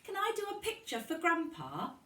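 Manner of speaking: put-on high, bright child's voice asking eagerly; a young girl asking her teacher hopefully; eager little girl. A narrator's reading. Child asking teacher if she may paint for Grandpa, high and eager. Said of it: "asked Lucy".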